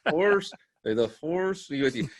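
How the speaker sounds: slow, jaw locked, barely moving lips; clenched teeth. Retold or imitated. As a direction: grit your teeth and push the words out. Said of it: "Are you speaking through clenched teeth?".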